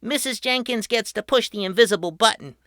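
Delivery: put-on quick, high eager kid voice; excited boy. A narrator's reading. Patrick giving Mrs Jenkins the button, quick and eager. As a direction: rush it out like a giddy child. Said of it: "he said".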